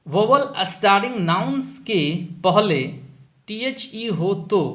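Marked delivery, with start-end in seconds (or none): 0.0-2.8 "vowel starting nouns के पहले" read louder, stressing "vowel"; emphatic reading. Shown as a demonstration. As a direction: press the rule on the class. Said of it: "देखिए यहाँ पर". ("Vowel" पर ज़ोर देकर नियम पढ़ना)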